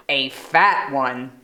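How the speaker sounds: low, slow goat voice. Acted. Mister Goat chiming in, slow and low.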